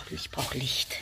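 whispered aside. Real, not acted. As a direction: whisper it to yourself, searching in the dark. Sucht im Dunkeln, murmelt. (leise geflüstert, für sich)

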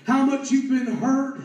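high, loud, driving cadence; impassioned preaching. Real, not acted. Preacher exhorts the congregation to remember their decision, impassioned. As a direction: build the repeated phrases at full force.

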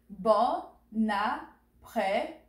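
"Bon après-midi" slowly, each syllable apart. Shown as a demonstration. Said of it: "Repeat after me".

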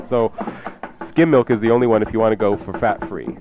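usual speaking voice

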